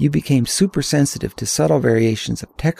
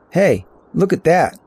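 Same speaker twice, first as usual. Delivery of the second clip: calling attention; 5 semitones higher, 4 dB louder than usual. A narrator's reading. Narrator points out view to Bobby.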